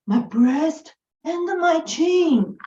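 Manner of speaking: ordinary delivery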